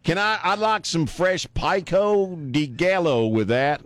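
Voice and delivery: slow, high, overly careful pico de gallo; ordering at Jalisco's with clumsy Spanish; mock bad Spanish. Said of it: "That's who I am".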